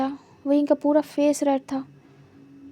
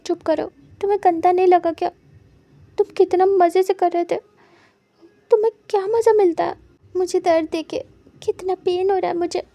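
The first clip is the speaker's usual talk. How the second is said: higher and louder, hurt and furious; angry outburst; 5 semitones higher, 5 dB louder than usual. A narrator's reading. Wing yelling at Wang Ji for hurting him, angry and loud. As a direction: lash out at him for the pain.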